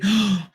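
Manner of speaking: a hammed-up gasp of shock; mock gasp at the spy reveal. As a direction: fake a shocked intake of breath.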